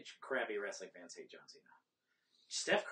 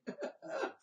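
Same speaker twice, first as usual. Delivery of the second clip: breathy laugh; 6 dB quieter than usual. Real, not acted. Laughs at clip.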